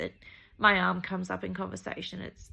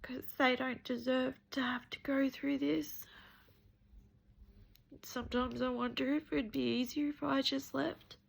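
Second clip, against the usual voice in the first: fighting back tears while talking, higher voice; crying; 6 semitones higher than usual. Real, not acted. To her followers about her arm, crying for real. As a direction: voice breaking into high, tearful sobs. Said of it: "Sorry, I don't mean to cry".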